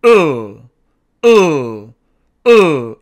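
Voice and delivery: eul held with the tongue tip pressed to the gum ridge; loud eul with tongue already touching; 으 sounded as a held l, tongue on the gum ridge; correct l sound. Shown as a demonstration. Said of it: "정확한 발음을 제가 세 번 해볼게요".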